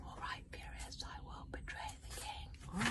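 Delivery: whispered, slow and soft; hushed whisper. A narrator's reading. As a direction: breathe the line out slow. Line reread hushed.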